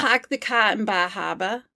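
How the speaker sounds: New England accent, dropped r's; own regional accent. Shown as a demonstration. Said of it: "I do my accent really well".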